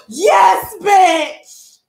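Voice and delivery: excited shout. Real, not acted. Thrilled with her new ring, shouting yes loud. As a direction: cheer it out loud.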